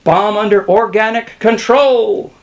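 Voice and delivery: loud, high triumphant announcement; crying out. A narrator's reading. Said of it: "cried Skinner".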